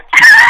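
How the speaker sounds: high squealing laugh; sudden bright giggle burst; burst of high laughter. Real, not acted. Laughing at own joke.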